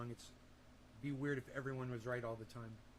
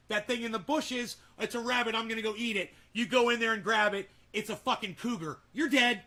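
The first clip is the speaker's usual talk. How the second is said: louder, rising into a hammed-up yell at the cougar; mock caveman panic; 6 semitones higher, 9 dB louder than usual. Streamer acting out a caveman grabbing a cougar, loud mock panic. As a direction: play the doomed caveman, building to a shout.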